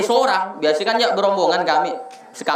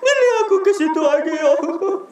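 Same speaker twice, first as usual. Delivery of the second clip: high-pitched wailing prayer, hammed up; mock crying; 9 semitones higher than usual. Pilgrim crying out to God at the holy site, mock wailing. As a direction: imitate a weeping pilgrim pleading to God. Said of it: "paling nangis di sini".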